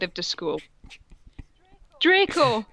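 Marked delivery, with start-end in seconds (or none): 2.0-2.7 cried out. Acted. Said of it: "I cried".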